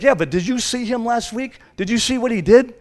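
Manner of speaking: high, pointed mock accusation; gossiping accuser. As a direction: play the church busybody pointing a finger. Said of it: "It's like".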